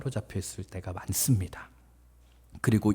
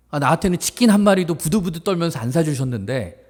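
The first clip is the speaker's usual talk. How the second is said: indignant protest building louder and higher; angry outburst; 5 semitones higher, 5 dB louder than usual. Acted. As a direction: complain bitterly to father like the wronged elder son. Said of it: "보는 앞에서 승질을 냅니다". (억울해서 화내며 따지듯)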